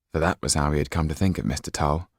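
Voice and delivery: ordinary delivery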